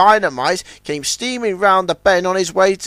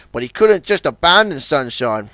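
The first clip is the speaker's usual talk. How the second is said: ordinary delivery, nothing marked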